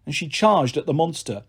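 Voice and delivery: ordinary delivery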